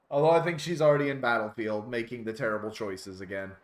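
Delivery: normal talking voice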